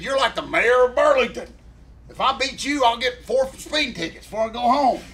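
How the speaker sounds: teasing retort. Retold or imitated. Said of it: "I said".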